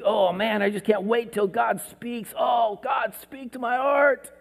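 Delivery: high, excited cry to God; eager longing. Retold or imitated. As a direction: reach up, begging him to speak. Eager prophet awaiting God's voice.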